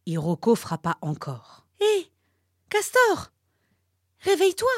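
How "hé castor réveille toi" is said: high, loud call to wake him; turtle cries out. A narrator's reading. Turtle calls the sleeping beaver, high and loud. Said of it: "s'écria la tortue".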